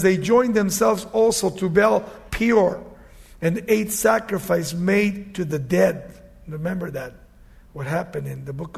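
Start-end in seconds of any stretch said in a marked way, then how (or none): 0.0-6.1 loud, slow proclamation; forceful scripture reading. Acted. Preacher reads of sacrifice made to the dead, forceful preaching. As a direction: declaim the verse, drawing out the words.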